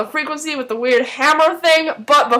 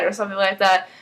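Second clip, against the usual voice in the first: usual speaking voice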